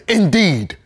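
high, loud, falling exclamation; ringing, scornful "Indeed". Acted. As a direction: throw out "Indeed" high and hard. Scornful echo of the creed.

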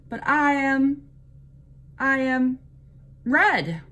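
high, loud proud cardinal; proud announcement. A narrator's reading. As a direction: announce it grandly, stalling for words. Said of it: "announced the cardinal".

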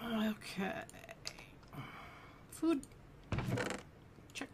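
soft breathy whispered okay. Real, not acted. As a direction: whisper it to yourself. Quiet item check.

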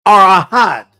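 ahad said as Hebrew, ah-HAD. Shown as a demonstration. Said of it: "Hebrew word ahad".

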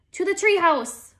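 high, loud rallying cry; urgent shout. A narrator's reading. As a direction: call out the escape route urgently. Urgent call while fleeing monster.

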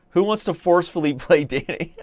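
high-pitched, laughing through the words. Real, not acted. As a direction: crack up as you ask. Amused by a forced game.

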